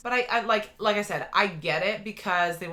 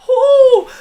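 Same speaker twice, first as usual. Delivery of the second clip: a loud, high cheer of delight; excited whoop; 20 dB louder than usual. Real, not acted. Fan cheering the old Loki scene, loud high whoop. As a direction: whoop like your team just scored.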